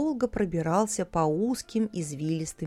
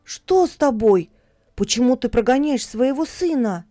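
1 alarmed plea; 4 semitones higher, 6 dB louder than usual. A narrator's reading. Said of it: "испугался Якоб".

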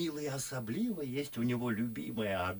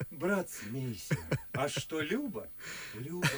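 laughing self-mockery. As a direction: laugh at your own confession. Laughing at himself.

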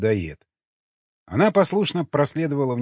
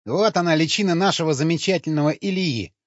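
higher, mildly grumbled sarcasm; grumbling; 6 semitones higher, 3 dB louder than usual. A narrator's reading. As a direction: grumble it with raised pitch. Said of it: "проворчал Сквернослов". (ворчливо, повышенным тоном)

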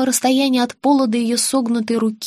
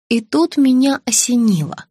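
ordinary delivery, nothing marked

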